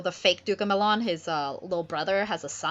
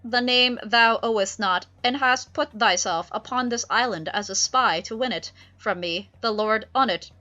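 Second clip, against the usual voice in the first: put-on louder, commanding old duke; Prospero's voice; 4 semitones higher, 7 dB louder than usual. Prospero accuses Ferdinand of spying, loud and stern. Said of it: "he's like".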